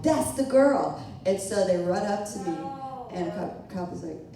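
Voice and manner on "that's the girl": higher, louder call from a bystander; imitated yell. Bystander pointing her out to the police, yelling. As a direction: yell it out like an accuser in the crowd. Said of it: "some guy yelled".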